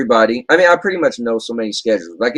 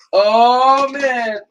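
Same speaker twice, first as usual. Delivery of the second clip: higher, louder, drawn out oh man; catchphrase while spinning; 9 semitones higher, 4 dB louder than usual. Acted. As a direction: sing it out while turning. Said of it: "at home I could go".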